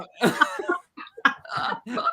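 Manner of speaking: unvoiced airy laughter; breathy laugh. Real, not acted.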